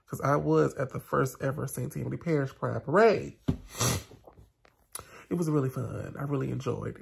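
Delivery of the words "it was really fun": usual speaking voice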